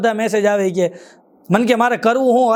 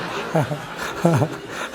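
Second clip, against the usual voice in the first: breathy laugh; 7 semitones lower, 7 dB quieter than usual. Real, not acted. Laughs after thief joke.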